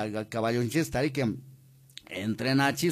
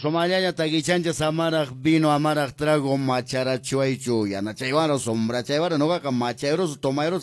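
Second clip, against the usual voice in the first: loud, high radio host opening; booming station host; radio announcer; 5 semitones higher, 7 dB louder than usual. Acted. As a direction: announce the show to the whole valley. Radio host opens the show.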